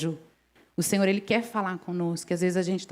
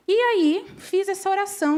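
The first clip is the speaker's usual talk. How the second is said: much higher, louder storytelling burst; excited emphasis; 11 semitones higher, 4 dB louder than usual. Real, not acted. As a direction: lift the voice high with excitement. Retelling the prayer eagerly. (empolgada, voz bem aguda)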